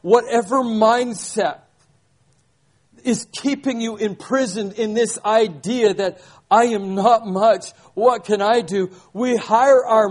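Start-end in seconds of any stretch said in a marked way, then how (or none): none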